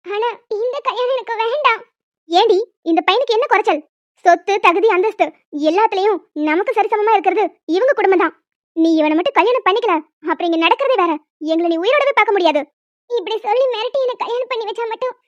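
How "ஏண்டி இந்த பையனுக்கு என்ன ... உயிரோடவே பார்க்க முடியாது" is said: loud, forceful threatening tirade; furious louder scolding; angry mother. Acted. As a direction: shout her down, lower and harder.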